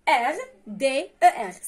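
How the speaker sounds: each letter spelled out slowly; slow spelling. Shown as a demonstration. Teacher spelling Regarder slowly to pupils. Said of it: "كما ينطق يكتب".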